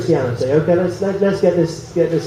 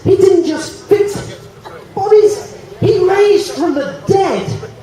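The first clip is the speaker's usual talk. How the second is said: emphatic preaching; 9 semitones higher, slower than usual. Real, not acted. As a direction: build it up and proclaim. Climax of preaching.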